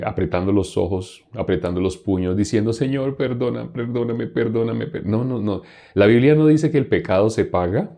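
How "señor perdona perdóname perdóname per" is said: mock pleading. Preacher imitates someone begging God for forgiveness, mock pleading. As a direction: beg on repeat like an exaggerated penitent. Imita a quien pide perdón.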